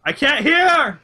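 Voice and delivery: high, loud hammed-up yell; mock anguished shout. Podcaster playing a man made deaf, mock anguished shout.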